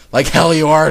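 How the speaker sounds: loud outraged retort; screamed protest. A narrator's reading. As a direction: yell it in refusal.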